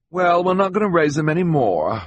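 high, loud dismissive woman; haughty Esme. Acted. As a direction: declare it loudly, pitched high.

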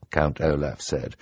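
plain reading voice

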